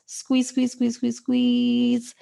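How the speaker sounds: high, held, effortful coaching chant; urging squeeze. Real, not acted. Yoga teacher urging students to tense muscles, high urging. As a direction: keep them squeezing on a high note.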